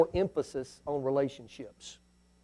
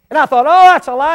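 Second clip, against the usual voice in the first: mock disbelief; 8 semitones higher, 12 dB louder than usual. Retold disbelief.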